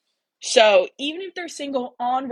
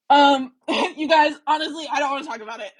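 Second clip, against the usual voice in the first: laughing; 4 semitones higher, 4 dB louder than usual. Real, not acted. Embarrassed refusing to talk.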